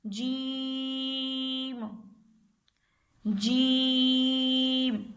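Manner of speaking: "जीम" with the vowel held very long, five alifs' worth; long drawn vowel. Shown as a demonstration. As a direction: stretch out "जीम" slowly, holding the long vowel. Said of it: "जीम को हम क्यों खींच के पढ़ेंगे".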